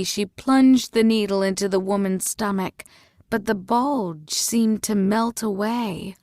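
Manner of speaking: plain reading voice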